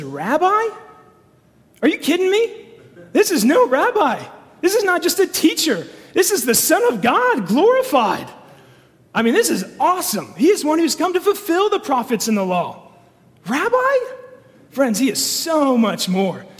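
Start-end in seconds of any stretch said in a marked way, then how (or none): 0.0-12.9 higher and louder, incredulous and exalted; preacher rising in awe at the glory; excited outburst. Acted. Preacher bursts out against Peter's word. 13.5-14.0 much higher rising question; incredulous echo. Acted. Incredulous echo of Peter's word.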